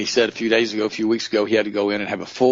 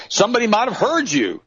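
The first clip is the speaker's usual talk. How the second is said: much higher, lightly mocking voice; mock critics; 13 semitones higher, 4 dB louder than usual. Preacher mocks people fretting about praying in public, higher mock voice. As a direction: send up the worried critics. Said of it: "and people say".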